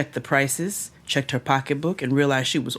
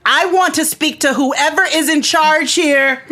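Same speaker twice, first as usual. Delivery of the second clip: loud, high demanding yell; 11 semitones higher, 6 dB louder than usual. Acted. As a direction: yell it out to the whole room. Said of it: "stepped up on the block and yelled out".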